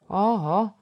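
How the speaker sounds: put-on a curious girl's exclamation; calling out. A narrator's reading. Girl spotting guests, calls out. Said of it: "zawołała Ewa".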